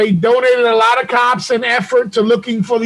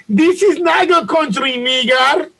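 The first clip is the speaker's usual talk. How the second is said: high-pitched Nigerian accent impression; exaggerated Nigerian-accented shout; mock Nigerian accent; 5 semitones higher, slower than usual. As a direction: imitate a Nigerian attacker's accent. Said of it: "if there was audio in the video".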